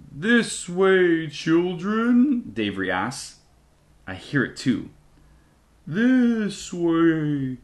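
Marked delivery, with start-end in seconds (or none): 0.1-2.4 high, drawn-out ghostly call; eerie moaning voice. A narrator's reading. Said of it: "Another moan em-, emanates from the valley path". 5.9-7.6 high, long-drawn spectral call; eerie moaning voice. A narrator's reading. Ghostly voice beckons from the valley path, eerie high moan. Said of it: "Another moan em-, emanates from the valley path".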